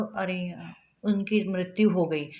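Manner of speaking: low, subdued sad announcement; sorrowful. Shown as a demonstration. Teacher acts out telling of a death, quietly sad. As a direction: break the bad news softly and low. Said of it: "सामने वाला बहुत दुखी है".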